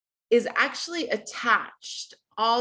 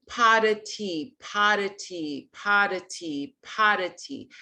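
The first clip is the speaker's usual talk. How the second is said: slow, deliberate pot of tea; slow practice; slower than usual. Shown as a demonstration. Voice coach demonstrating the phrase slowly. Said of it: "So you can practice saying".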